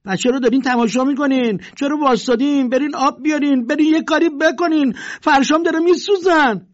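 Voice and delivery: shrill, loud scolding of the crowd; aggressive yelling. Acted. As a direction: bark orders at the gawkers. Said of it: "پرخاشگرانه به اطرافیان گفت".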